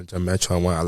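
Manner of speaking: normal talking voice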